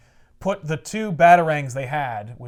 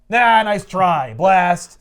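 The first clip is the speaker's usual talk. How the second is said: mock dismissal; 3 semitones higher, 7 dB louder, much slower than usual. Mock brush-off by Superman.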